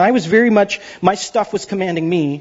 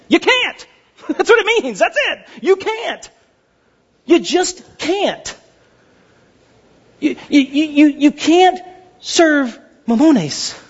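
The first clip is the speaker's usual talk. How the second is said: much higher, laughing, then a slow, drawn-out you can't; emphatic preaching; 9 semitones higher, slower than usual. Acted. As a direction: hammer the point home with rising fervour. Preacher hammering the point home.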